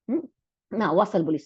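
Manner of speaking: ordinary delivery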